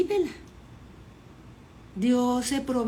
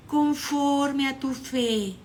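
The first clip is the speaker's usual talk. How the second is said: slow, drawn-out, raised declaration; solemn pronouncement; 5 semitones higher, much slower than usual. Acted. Jesus grants healing to Bartimeo, pronouncing it slowly. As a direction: proclaim it slowly, stretching the words.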